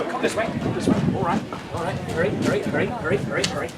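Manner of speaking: soft, partly whispered urging; conspiratorial hush; hushed hurry. Acted. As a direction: hustle him along secretly. Hurrying Banquo along secretly.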